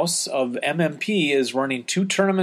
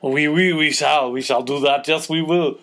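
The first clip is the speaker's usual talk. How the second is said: hammed-up French accent, louder; outrageous French accent; 4 dB louder than usual. Answering a fan's request, booming a mock French accent. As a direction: play a cartoon Frenchman, fully over the top with the French accent. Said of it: "you will employ your outrageous French accents".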